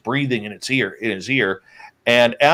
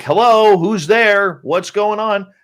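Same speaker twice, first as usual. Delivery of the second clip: loud, high call into the trees; 10 semitones higher, 5 dB louder, slower than usual. Retold or imitated. Searchers calling into the forest, loud and high. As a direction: shout it out to whoever is hiding.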